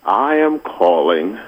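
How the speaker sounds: ordinary talk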